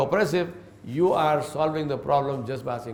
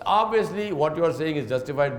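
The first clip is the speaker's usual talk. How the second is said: ordinary talk, nothing marked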